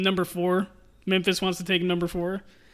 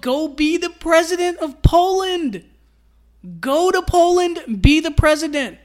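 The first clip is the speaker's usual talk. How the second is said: mock pleading; 9 semitones higher, 6 dB louder than usual. Real, not acted. Fan begging the center to go be president, loud and high. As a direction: beg him to leave, half laughing.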